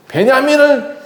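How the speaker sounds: the father refusing to lose another son, in a high angry voice; angry outburst. Acted. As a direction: refuse them, voice climbing high in anger. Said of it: "화를 내면서".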